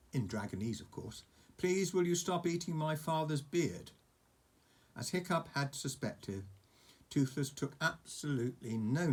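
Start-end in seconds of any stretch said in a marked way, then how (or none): 1.6-3.9 feeble polite yell. Acted. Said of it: "yelled as loudly as he could".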